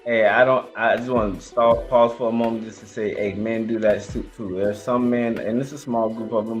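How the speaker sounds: normal talking voice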